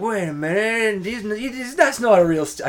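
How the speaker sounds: high, slow mock accuser; a mock high voice of someone exposing a fraud; imagined accuser. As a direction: play the suspicious listener catching a fake. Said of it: "someone's gonna be like".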